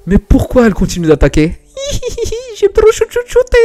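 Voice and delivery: put-on high, loud, gleeful young huntress; giddy heroine. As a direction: revel in shooting everything, giggling. Plays the heroine's lines.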